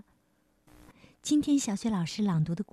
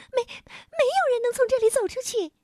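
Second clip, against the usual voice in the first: very high, squeaky stammer; little moon creature; 3 dB louder than usual. A narrator's reading. Little man warns the lost king, high squeaky voice. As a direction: stutter it out in a tiny piping voice.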